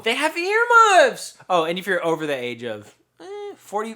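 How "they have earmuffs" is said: high, slow, loud exclamation; excited shout. Pretend. Mock outrage at own joke.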